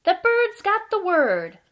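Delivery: loud, deliberate picture-book narration with pauses; reading to children. A narrator's reading. Storyteller reads a bedtime tale about Fred and Ted, lively read-aloud. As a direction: read it out with big, bouncy emphasis.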